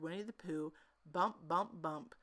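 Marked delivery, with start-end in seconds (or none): none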